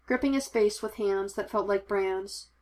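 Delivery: ordinary delivery, nothing marked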